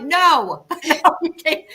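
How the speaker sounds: high, loud playful no; imitating telling kids no; mock strict mom. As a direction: laugh through a pretend refusal. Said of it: "I'm like".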